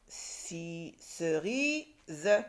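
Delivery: CERISE slowly pulled apart into ce-ri-ze; syllable by syllable. Shown as a demonstration. Teacher reads the written word, CERISE split into syllables. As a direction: sound out CERISE with a separate final ZE. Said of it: "je termine par la dernière syllabe".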